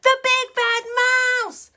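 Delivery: high, loud storybook yell; a child's big triumphant shout of "mouse". Acted. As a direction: bellow it out like a fierce little monster. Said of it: "yelled the Gruffalo's child".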